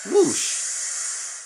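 high breathy whoosh; steam whoosh. A narrator's reading. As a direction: let off steam loudly. Letting off steam.

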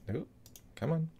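normal talking voice

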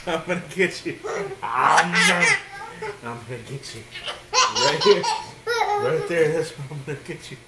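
loud, giggling gonna-get-you; laughing tickle threat. Acted. Dad tickling the boys, loud giggly threat. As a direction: chase them while laughing.

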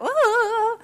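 very high, far-off squeal of wonder; tiny awed coo; toddler's delighted ooh. Retold or imitated. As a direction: squeal like a thrilled little girl. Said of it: "She'll be just like".

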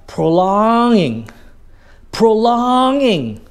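prolonging stretched out high; prolonged word. Shown as a demonstration. Teacher demonstrating the trick, prolonging high and elongated. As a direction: draw the middle of prolonging out very long. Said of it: "the word that you want to emphasize".

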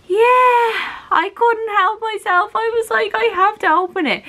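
high, giddy confession; excited gushing. Real, not acted. Vlogger admitting she had to open it, giddy. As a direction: gush it in a high squeal. Gushing about being unable to wait.

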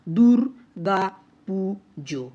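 দুর্গা পুজো in four slow, separate syllables. Shown as a demonstration. Teacher has students count the units in দুর্গা পুজো, syllable by syllable. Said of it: "একটু গুণে দেখে নাও".

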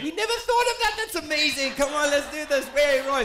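hammed-up high, loud, thrilled crowd reaction; mock delighted excitement. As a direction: gush loudly as an imagined amazed crowd.

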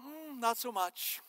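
drawn-out, hesitant "Mm" and slow letdown; slow, softened mock disapproval; doubtful verdict. Everyone else judging the shower singer, slow and doubtful.

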